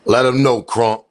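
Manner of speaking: loud call-out; loud, fired-up shout. Real, not acted. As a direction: yell it out at the screen. Shouting back at the clip.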